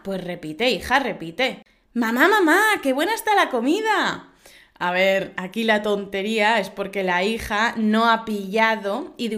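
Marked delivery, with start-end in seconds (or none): 1.9-4.3 put-on high, even louder child calling again; the girl repeats it, missing the joke; little girl voice.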